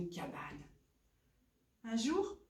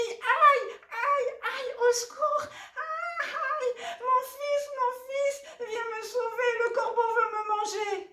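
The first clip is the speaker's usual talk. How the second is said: very high, loud cries of pain; screaming for help; 8 dB louder than usual. Acted. Mother fish pecked by crow calls her son, screaming. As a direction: shriek in acted panic, calling for rescue. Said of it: "maman poisson crie".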